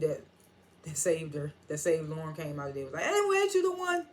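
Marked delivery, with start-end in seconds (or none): 3.0-4.1 high put-on voice, calling out; a stranger spotting her; much higher comic bystander voice; imagined passerby.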